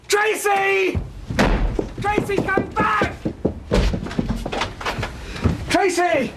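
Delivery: high, pleading calls, drawn out; calling after her. Acted. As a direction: call her back as she runs. Calling after daughter running off.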